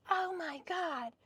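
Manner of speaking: high, slow, put-on neighbor voice; mimicking the neighbor lady. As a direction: neighbor lady scolding about her leaves, high and slow. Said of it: "She goes".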